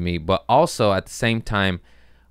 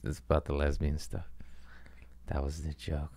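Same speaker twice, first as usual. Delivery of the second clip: breathy whisper of the punchline; whispered aside; 4 semitones lower, 13 dB quieter than usual. Real, not acted. Tells the cohost the punchline in a whisper. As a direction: let it slip out under the breath.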